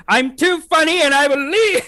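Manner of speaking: high, drawn-out mock outrage; hammed-up diva. Co-host playing someone too funny for the show, in hammed-up indignation. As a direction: stretch it upward into a theatrical squeal.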